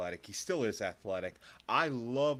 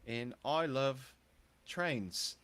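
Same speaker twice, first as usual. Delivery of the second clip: slow, higher-pitched, badly faked Scouse; Scouse accent attempt; 5 semitones higher, 5 dB louder than usual. Co-host introduces himself on a challenge, halting mock Scouse accent. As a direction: drag out a hammy Scouse self-introduction. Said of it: "give me your best Scouse accent".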